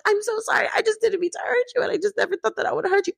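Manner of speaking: high-pitched imitation of crying; mock tearful apology. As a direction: plead in a high, put-on weepy voice. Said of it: "Teresa starts to cry".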